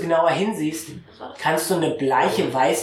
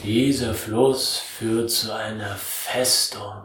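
langsame Flussstimme; 2 semitones lower, slower than usual. Acted. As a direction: drag each word out like something very old. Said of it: "Er sagt". (tiefe, langsame Stimme eines uralten Wesens)

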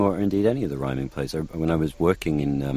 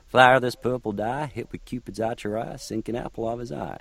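Oberon lines in a higher Southern American accent; 7 semitones higher than usual. Shown as a demonstration. Coaching the actor to find a Southern American accent that rhymes. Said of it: "Do it in Southern American".

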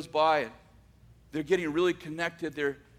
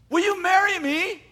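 much higher, louder eager proposal; blurted proposal; 13 semitones higher, 9 dB louder than usual. Retold or imitated. Johnny proposing to the nurse, a loud eager blurt. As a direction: blurt it out all at once.

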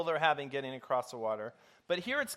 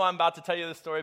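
normal talking voice

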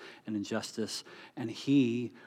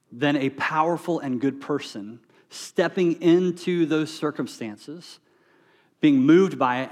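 louder and higher, driving the point home; preaching at full force; 4 semitones higher, 8 dB louder than usual. Real, not acted. Pastor rallying his congregation, preaching at full force. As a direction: build the congregation up to reassurance.

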